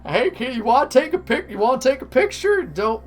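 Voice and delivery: high, eager theme park mascot. Acted. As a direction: pitch it up like a pushy costumed mascot. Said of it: "I don't know what voice a Pikachu Dark Magician talks in".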